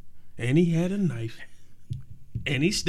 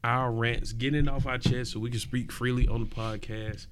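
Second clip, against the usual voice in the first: ordinary talk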